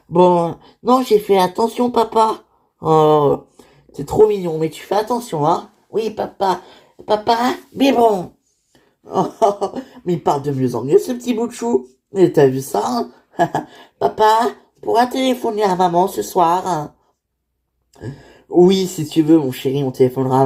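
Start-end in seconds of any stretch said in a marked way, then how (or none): 0.1-2.4 lightly voiced small child, proud; a small boy telling his dad what he did; little boy voice. Small son reassures his father. 5.9-8.3 lightly voiced toddler asking for his bottle; a small child pleading to his dad for a bottle; little boy voice. 14.0-16.9 lightly voiced toddler asking for his bottle; a small child pleading to his dad for a bottle; little boy voice. Child asks to call mother.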